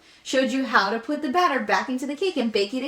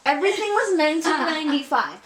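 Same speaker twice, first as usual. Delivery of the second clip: mock ad emphasis; 7 semitones higher, 5 dB louder than usual. Mimicking ad pricing.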